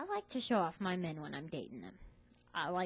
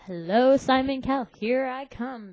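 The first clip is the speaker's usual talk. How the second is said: much louder, triumphant call-out; excited hype; 5 semitones higher, 12 dB louder than usual. Retold or imitated. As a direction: announce her arrival loudly. Friend hyping her for Idol.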